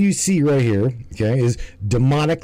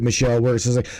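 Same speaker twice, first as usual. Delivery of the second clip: usual speaking voice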